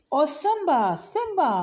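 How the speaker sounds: high, squeaky plea; pleading mouse. A narrator's reading. Little mouse pleads with the great lioness. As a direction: beg in a tiny high voice. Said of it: "pleaded Mouse".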